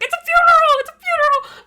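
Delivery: high, loud panicked yell; frantic shout. Retold or imitated. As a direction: shout it out in alarm. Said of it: "I was like".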